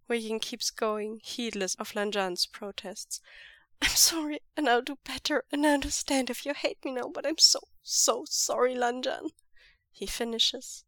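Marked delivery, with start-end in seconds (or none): 3.8-9.3 desperate apology. A narrator's reading. Keeps apologizing, heedless of protests.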